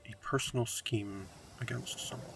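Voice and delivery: slow, hushed plotting whisper; a slow, quiet villain's musing; scheming whisper. Real, not acted. Considering a scheme.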